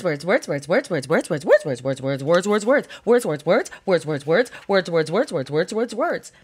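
low, nonstop run of one repeated word; monotonous rattling. Acted. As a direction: rattle them off like an endless crammed page. Mimics a page crammed with words.

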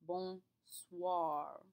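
Bonsoir with soir said swar. Shown as a demonstration. Teacher showing students the wa sound in bonsoir. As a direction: let the OI sound like wa. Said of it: "Hear how it kinda sounds like a W?".

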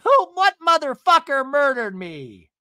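loud, high indignant shriek; hammed-up fury at being singled out; mock outraged yell. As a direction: yell it like an outraged reality star. Acting out Tamara's outraged reaction.

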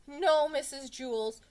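high, loud, stretched-out plea; wailing schoolboy appeal; begging. A narrator's reading. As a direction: beg the teacher desperately. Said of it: "begged Jason".

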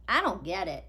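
put-on lively read-aloud for kids; storybook reading. A narrator's reading. Reader telling children a picture book story, lively.

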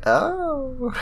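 very high witch "oh" and cackle. Acted. Witch gloating over the spell, high cackle.

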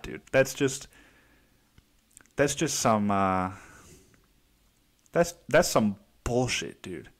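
normal talking voice

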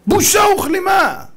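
high, slow, loud condemnation; scornful denunciation. Real, not acted. Preacher denounces a lying politician, scornful shout. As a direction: shout it out, drawing out each word.

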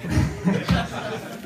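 airless high giggle; breathy giggle. Real, not acted. Laughing after the story.

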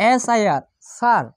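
S-I-R spelled out, then স্যার said as sir. Shown as a demonstration. Teacher showing the er sound, spelling then saying sir. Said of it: "আই শব্দটি আর মতো উচ্চারণ করবে".

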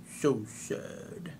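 a quiet, fake-sorry sigh of a line; mock sad. Coach mocking the client's sad news, softly.

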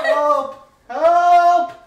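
high, loud, half-sung calls of help; loud mock cry for help. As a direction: wail help like a cartoon victim.

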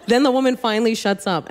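ordinary talk, nothing marked

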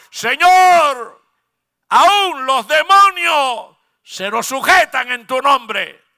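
loud, slow cry to the Lord; loud exultant cry of the seventy; joyful shout. Retold or imitated. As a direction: call it out triumphantly. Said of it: "Le gritaron".

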